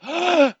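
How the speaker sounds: high gasp, cut short; acted gasp. Teen about to yell before mum stops him, mock gasp. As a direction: suck in breath to start yelling. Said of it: "I went".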